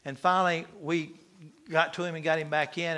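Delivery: usual speaking voice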